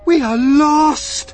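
high, loud, drawn-out wail; despairing cry. A narrator's reading. Ladybird cries out as the peach falls, despairing wail. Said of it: "cried the ladybird".

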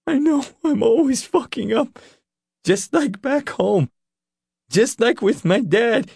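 high, wretched self-blame; tearful confession. Acted. Leroy admitting his failings to Nick, tearful.

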